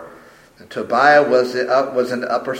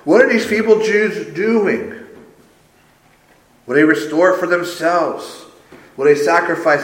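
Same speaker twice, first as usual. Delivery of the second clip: higher, louder mocking question; sarcastic, raised-pitch taunt; dripping sarcasm; 4 semitones higher, 4 dB louder than usual. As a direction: scorn on each syllable of the sneering questions. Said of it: "We can hear the sarcasm dripping off at each syllable".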